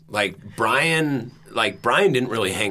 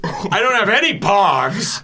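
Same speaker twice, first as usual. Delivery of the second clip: put-on whiny kid voice; 7 semitones higher, 5 dB louder, slower than usual. Joins the mock kid bit.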